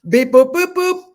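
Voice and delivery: loud, high robotic beeping; imitated computer beeps. Co-host mocking CSI computer, loud imitated beeps. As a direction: beep out a result like a computer.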